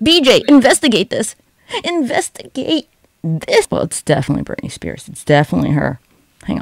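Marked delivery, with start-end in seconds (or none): 0.0-1.4 high, raised call; urgent mock command. Calls on BJ to investigate. 1.8-3.7 INVESTIGATE stretched slow and high; drawn-out mock command.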